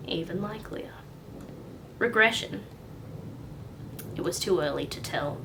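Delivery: ordinary delivery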